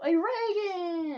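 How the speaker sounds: high, loud, stretched greeting; joyful shout. Acted. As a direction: call out to him with delight. Naruto greets old friend joyfully.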